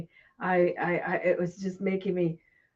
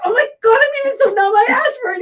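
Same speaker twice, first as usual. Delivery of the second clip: laughing exclaim; 12 dB louder, faster than usual. Retold or imitated. Retelling her own disbelief, laughing.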